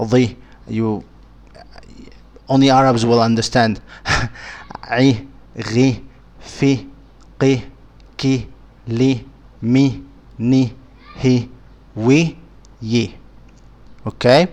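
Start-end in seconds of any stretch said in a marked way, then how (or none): 4.0-13.1 each letter carefully said with a short i; letters with kasra. Shown as a demonstration. Teacher reciting Arabic letters with kasra, carefully pronounced. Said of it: "And then B".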